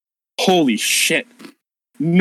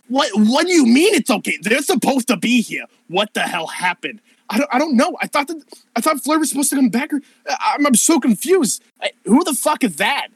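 much higher, rattled and confused; panicked NPC; 11 semitones higher than usual. Acted. NPC panicking when backup never arrives, much higher. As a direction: spiral into panic.

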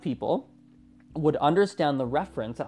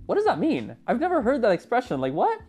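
higher, quicker mock confusion; a friend lost at new slang; baffled; 7 semitones higher than usual. The friend hears unfamiliar Lima slang from friends, higher mock bafflement. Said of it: "is always like".

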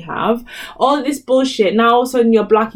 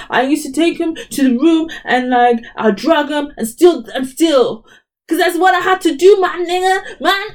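mocking [name]; 6 semitones higher than usual. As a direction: parody her boastful confession. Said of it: "just shouting and shit".

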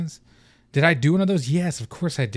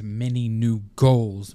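ordinary talk, nothing marked

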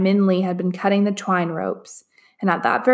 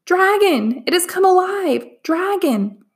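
screaming; 10 semitones higher than usual. A narrator's reading. Servant screams as the dragon comes alive. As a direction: shriek the warning in terror. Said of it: "I heard the servant screaming".

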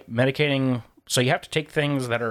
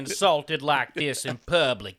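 imperious plummy grande dame; 7 semitones higher than usual. Retold or imitated. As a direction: play a scandalised grand lady. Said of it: "he just made a scene".

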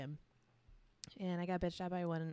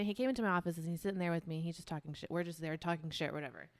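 normal talking voice

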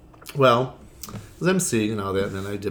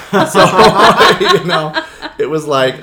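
laughing loudly through the words; real laughter breaking into the talk; cracking up at her own joke; 9 semitones higher, 14 dB louder, slower than usual. Real, not acted.

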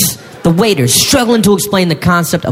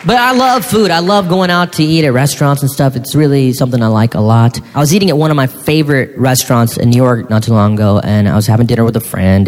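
usual speaking voice

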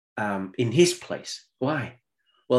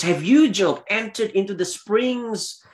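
God's challenge; 7 semitones higher than usual. Acted. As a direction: thunder the first words like God. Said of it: "God says".

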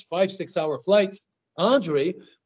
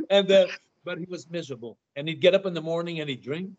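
normal talking voice

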